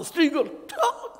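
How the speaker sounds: high, mocking parent egging on shouting; mocking imitation of a shouting parent with a laugh; mock yelling parent. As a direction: ham up a parent who says yell at him.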